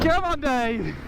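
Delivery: high, incredulous shouts; excited cheering. Real, not acted. Cheering on climb.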